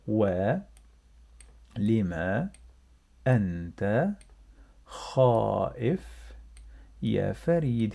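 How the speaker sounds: each word set apart with long pauses; slow word by word. Shown as a demonstration. Teacher to learners, slow word-by-word reading. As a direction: spell the question out one word at a time. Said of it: "let's read together".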